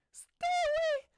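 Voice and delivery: shrill, very high, very loud scream; screaming stay away. Acted. Midori screaming at her brother to stay away. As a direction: shriek it at him in terror.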